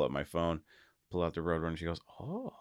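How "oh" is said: put-on high, soft toddler "oh"; dad imitating his toddler's soft, high wonder; little daughter's wow. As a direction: gasp it like a tiny kid seeing the car. Daughter sees the car photo.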